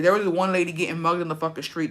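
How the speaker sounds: ordinary delivery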